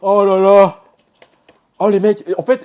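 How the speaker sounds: elated. Real, not acted. Student shares his relief with viewers, elated. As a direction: gush with relief.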